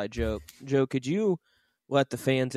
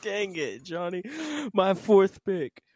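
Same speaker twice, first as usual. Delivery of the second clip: out of breath; 6 semitones higher than usual. Real, not acted. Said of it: "I gotta catch my breath".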